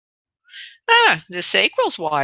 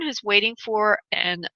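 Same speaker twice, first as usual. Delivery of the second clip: ordinary talk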